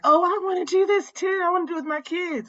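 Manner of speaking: high, excited mock teacher; chirpy eager quote. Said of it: "they're like".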